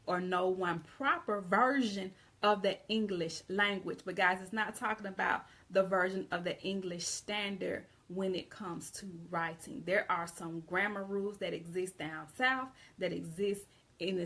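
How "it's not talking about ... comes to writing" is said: ordinary talk, nothing marked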